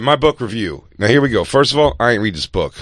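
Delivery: usual speaking voice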